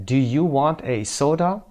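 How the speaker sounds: slow, clear question with a rise; slow correct answer. Shown as a demonstration. Teacher reading the correct answer to learners, slow. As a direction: model the right form slowly. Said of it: "گزینهٔ B صحیح هست".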